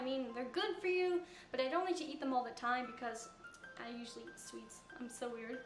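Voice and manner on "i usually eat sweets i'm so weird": normal talking voice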